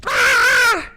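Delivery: piercing high shriek; jump-scare scream. Acted. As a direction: shriek as if startled.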